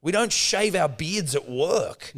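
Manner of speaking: retelling how he snapped back at the cleaner; mock defiance. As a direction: fire it back with swagger. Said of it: "I go".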